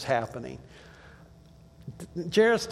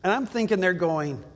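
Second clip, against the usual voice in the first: ordinary talk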